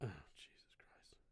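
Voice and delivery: whispered under the breath; very quiet, low whispered reaction; hushed exclamation. Real, not acted. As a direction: let it out in a whisper. Low reaction during a long pause.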